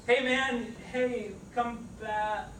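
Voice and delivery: high urgent call after the dog. Acted. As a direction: call him back in a panic. Calling after fleeing dog.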